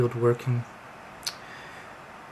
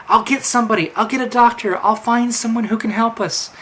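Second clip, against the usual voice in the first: urgent; 6 semitones higher, 4 dB louder than usual. Acted. Quinter rushing for a doctor.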